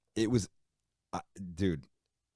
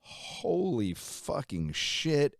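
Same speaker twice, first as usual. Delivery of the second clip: drawn-out, higher stunned curse; stunned disbelief; 8 semitones higher, much slower than usual. Retold or imitated. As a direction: let it sink in slowly, in shock. Reacting to label news.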